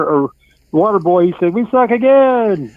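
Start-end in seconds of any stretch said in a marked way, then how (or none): 1.5-2.7 drawn-out, higher cry of a movie heckler; hollered film-quote impression; Rob Schneider. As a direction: call it out long like a comic bit player. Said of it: "like the Rob Schneider said in The Wedding Singer".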